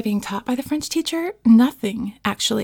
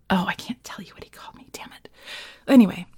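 ordinary talk, nothing marked

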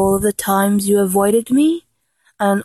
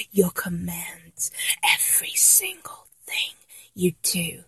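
hushed adoration; 3 semitones lower, 10 dB quieter than usual. Acted. Fan confessing devotion to idol, hushed adoration.

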